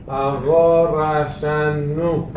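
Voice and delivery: very slow, doubled N in רשנו; רשנו said as rashan-nu, slowly; dagesh on the nun. Shown as a demonstration. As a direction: draw out רשנו with a long held N. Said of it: "זה דגש פה".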